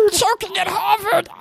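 high choking shout. Pretend.

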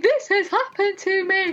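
high-pitched mock wail to the boyfriend; imitating a distraught phone call; retold tearful wail. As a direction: wail it in dismay, retelling being in bits. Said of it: "I was just crying".

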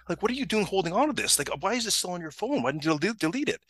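high-pitched, exasperated disbelief; incredulous questioning. Retold or imitated. Supervisor asking why she kept the photo, incredulous. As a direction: pile up the questions, appalled.

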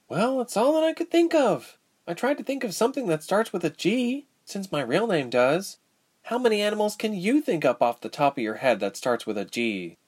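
put-on high-pitched, defensive girl; put-upon girl defending her choice. A narrator's reading. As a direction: justify yourself, starting way up in pitch. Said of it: "defending herself".

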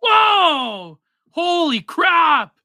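high, loud, slow cry of amazement; stunned shout. Real, not acted. Streamer hearing the donation is worth eighty-four dollars, amazed shout. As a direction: gasp it out, floored by the sum.

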